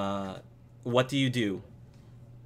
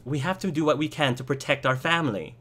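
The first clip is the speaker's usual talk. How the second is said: higher, fast pleading; urgent wife; 5 semitones higher, faster than usual. Acted. Wife urging her husband to protect family, urgent. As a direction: plead quickly as a worried wife. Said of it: "And his wife says".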